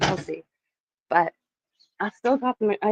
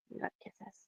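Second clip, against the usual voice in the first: soft unvoiced whisper to the dog; 18 dB quieter than usual. Real, not acted. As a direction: breathe it to the dog.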